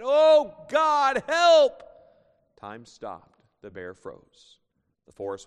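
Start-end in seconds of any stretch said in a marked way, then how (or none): 0.0-1.8 screamed far higher and much louder; desperate cry for help. Acted. Atheist under the grizzly's raised paw screaming to God. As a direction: shriek it as the claw comes down. Atheist cornered by charging bear.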